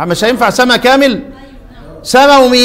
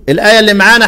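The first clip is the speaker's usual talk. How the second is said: normal talking voice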